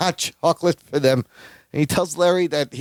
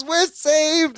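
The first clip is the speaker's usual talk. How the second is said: high, drawn-out cry of being saved; triumphant relief; 10 semitones higher than usual. Retold or imitated. Retelling the rescue after head bumps, triumphant relief. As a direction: finally get it out, pitched way up. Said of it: "then he stands up finally".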